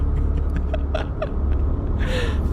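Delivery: long, wheezy voiceless laugh; helpless laugh. Real, not acted. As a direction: lose it laughing at your own stutter. Laughs at own stutter.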